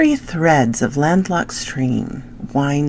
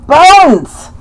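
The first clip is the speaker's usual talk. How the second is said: calling out; 12 semitones higher, 12 dB louder than usual. Real, not acted. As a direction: yell the dog's name high and loud. Calling the dog across the room.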